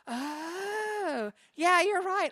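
high, drawn-out "oh" of realization; imitated high-pitched agreement; dawning realization. Acts out coworker's dawning realization.

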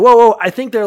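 calling out. Acted. Jonathan calling to the boy.

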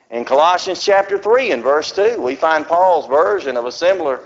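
ordinary talk

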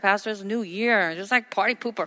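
usual speaking voice

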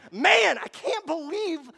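loud, high, drawn-out exclamation; anguished outburst. Acted. Guilt-ridden person suddenly erupting, anguished. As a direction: burst out as guilt overwhelms you.

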